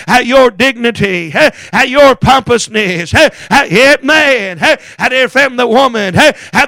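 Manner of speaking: ordinary delivery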